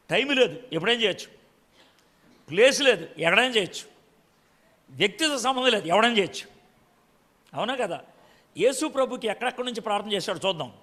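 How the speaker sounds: normal talking voice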